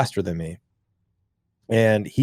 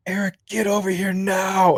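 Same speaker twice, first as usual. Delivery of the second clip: high, stern mom calling her son; mother calling her son over after the shooting, high and stern; angry mom; 9 semitones higher than usual. Retold or imitated. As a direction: summon the kid like a furious mother. Mom voiced in story.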